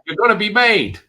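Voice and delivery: high, loud retold exclamation; excited mock announcement. Retelling Tommy walking in the door, excited mock announcement.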